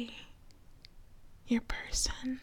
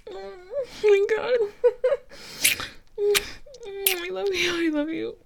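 high, tearful declaration of love; overwhelmed with joy; 9 semitones higher, 4 dB louder than usual. Acted. Newly engaged, holding their partner, overwhelmed with joy. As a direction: pour out love, voice raised in pitch. Embracing after the accepted proposal.

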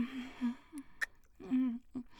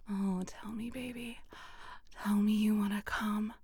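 normal talking voice

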